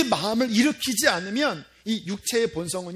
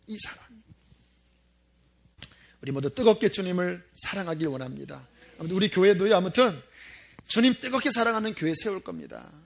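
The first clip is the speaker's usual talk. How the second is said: ordinary talk, nothing marked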